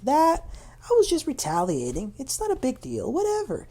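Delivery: high, breezy mock dismissal; downplaying. Imitating a castmate who downplays her own retaliation, dismissive. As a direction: exaggerate brushing it off as nothing. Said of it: "Tamra then downplays this".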